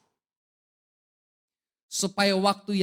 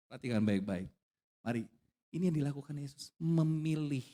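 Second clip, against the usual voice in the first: normal talking voice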